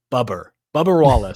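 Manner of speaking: "Bubba" said as "Bubber", r added at the end; imitated Bubber pronunciation. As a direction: imitate his accent with an r ending "Bubber". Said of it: "he pronounces Bubba".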